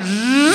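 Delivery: rising whizzing zzz; zipline whizz. Retold or imitated. Rushing upside down through the jungle, a rising whizz. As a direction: imitate racing down the cable. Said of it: "So I went".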